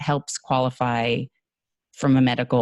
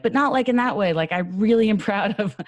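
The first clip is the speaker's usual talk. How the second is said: high-pitched hasty reassurance with a laugh; earnest qualifying; 9 semitones higher than usual. Retold or imitated. Speaker qualifying praise to a friend, earnest and high-pitched. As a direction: backpedal brightly to prove you mean it. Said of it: "but I qualify it".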